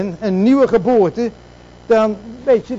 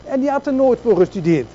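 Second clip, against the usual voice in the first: ordinary talk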